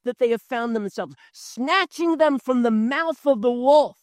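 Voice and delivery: high, driving preacher's fervor; fiery pulpit build on snatching; impassioned preaching. Acted. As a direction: preach it with rising force.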